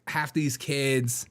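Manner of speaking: ordinary delivery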